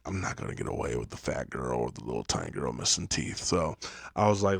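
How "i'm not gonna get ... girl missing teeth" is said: low, soft resigned aside; quiet defeated aside; resigned mutter. Retold or imitated. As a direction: mumble it to yourself.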